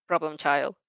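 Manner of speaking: gruff, low huff of a line; exasperated teacher huffing. A narrator's reading. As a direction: sigh it out like a tired teacher. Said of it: "he huffs".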